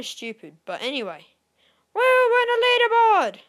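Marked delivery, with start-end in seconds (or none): 1.9-3.4 high, very loud victory whoop; whooping celebration. Real, not acted. Gamer celebrating a leaderboard place, whooping celebration. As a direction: cheer it out long and high.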